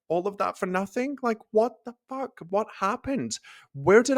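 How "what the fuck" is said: ordinary delivery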